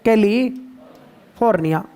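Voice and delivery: कैलिफोर्निया stretched slowly, every syllable clear; slow clear pronunciation. Shown as a demonstration. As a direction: draw out कैलिफोर्निया for the class to repeat. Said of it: "बोलो एक बार". (कैलिफोर्निया को धीरे-धीरे, हर अक्षर साफ़ बोलो)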